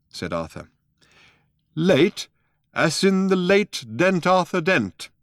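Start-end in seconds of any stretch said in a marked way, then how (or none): 1.8-5.1 high, slow, weighty threat; a lightly voiced stern old man. A narrator's reading. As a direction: deliver it as a stern warning. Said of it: "said the old man sternly".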